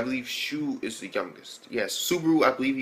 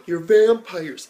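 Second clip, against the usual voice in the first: mock gasping realization; 7 semitones higher, 8 dB louder, much slower than usual. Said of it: "she's just like".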